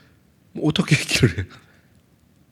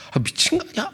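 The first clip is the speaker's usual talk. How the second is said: exasperated outburst; 14 semitones higher, 6 dB quieter than usual. Retold or imitated. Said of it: "막 이렇게 화가 나고".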